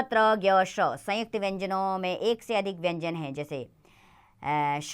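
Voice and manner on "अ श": ordinary talk, nothing marked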